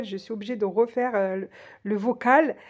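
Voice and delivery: ordinary delivery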